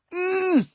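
high closed-mouth "mm" of protest; muffled protesting grunt. A narrator's reading. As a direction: protest through a gag. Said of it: "I protested".

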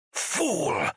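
high-pitched snarl of contempt. A narrator's reading. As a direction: snarl it as a sharp, high insult. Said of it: "Faris snarled".

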